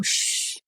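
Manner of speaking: unvoiced, soft warning shush; quiet hissed shh to silence a companion; hushing shh. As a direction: hush someone with a long "shh". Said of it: "जिससे डर लग रहा है".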